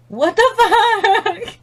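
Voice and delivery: slow, high laughing disbelief. Real, not acted. Laughing at her burning eyes. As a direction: laugh through the swear word.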